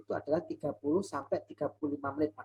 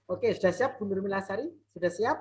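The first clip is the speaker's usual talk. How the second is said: louder; 5 semitones higher, 5 dB louder than usual. Real, not acted. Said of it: "Suaranya kecil".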